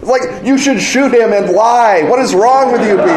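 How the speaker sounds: high exasperated laughing outburst; escalating comedy rant. Retold or imitated. Rant at Chicago-naive players.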